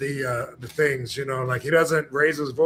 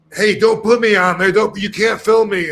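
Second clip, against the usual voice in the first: raised, louder protesting voice of someone being filmed; mock protest; 5 semitones higher, 8 dB louder than usual. Bystander yelling at the filming auditor, raised mock protest. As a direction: play the annoyed bystander shoving the camera away. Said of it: "they're always, you know, like".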